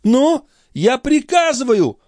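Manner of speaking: very high, slow imperious cry. A narrator's reading. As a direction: cry out a command, drawn out and high. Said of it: "выкрикнул Андреа". (властный выкрик очень высоким голосом)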